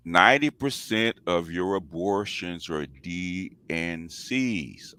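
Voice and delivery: slow, every word spelled out; over enunciating. Shown as a demonstration. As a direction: say it slowly, word by word. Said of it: "Let me say it slowly".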